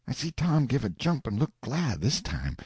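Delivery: ordinary delivery, nothing marked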